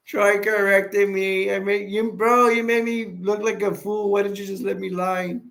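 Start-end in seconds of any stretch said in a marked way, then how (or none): none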